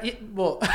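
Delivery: high shrieking burst of laughter. Real, not acted. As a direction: break into a squealing laugh.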